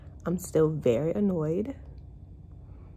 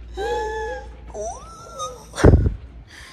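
sharp, high shocked gasp. Real, not acted. Reacting to the random photo, high shocked gasp. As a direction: gasp in outrage.

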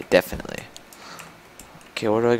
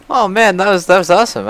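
higher, louder, delighted exclaim; thrilled; 7 semitones higher, 5 dB louder than usual. Real, not acted. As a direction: gush about it, thrilled. Thrilled by game moment.

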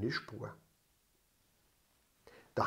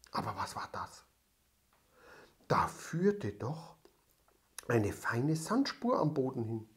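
hushed suspense; 3 semitones lower, 9 dB quieter than usual. A narrator's reading. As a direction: breathe it, mysterious. Suspense narration. (leise, geheimnisvoll)

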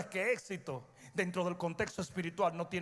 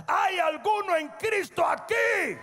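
higher, louder shouted call; louder retake; 11 semitones higher, 6 dB louder, slower than usual. Acted. Preacher repeating his question to wake the crowd, shouting. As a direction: ask it again, far bigger. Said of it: "Aquí va de nuevo".

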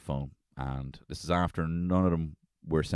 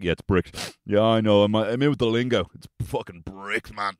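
smug mock tech lingo; 4 semitones higher, 7 dB louder than usual. Mocking himself using tech lingo.

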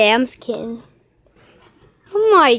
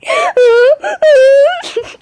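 loud, high, whooping laugh; 12 semitones higher, 5 dB louder than usual. Real, not acted. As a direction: howl with high-pitched laughter. Laughing fit during play.

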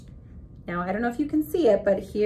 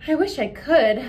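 miserable; 7 semitones higher, 6 dB louder than usual. A narrator's reading. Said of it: "thought Chrysanthemum miserably".

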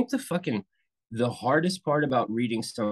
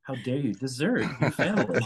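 mock accusing; 4 semitones higher than usual. Shown as a demonstration. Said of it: "Like, you say".

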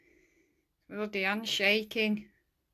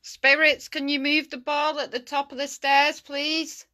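loud, higher call to spirits; shouting; 5 semitones higher, 5 dB louder than usual. Real, not acted. Hard-of-hearing ghost hunter asking spirits, shouting. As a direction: raise your voice to the unseen, politely. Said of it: "Don't mean to shout".

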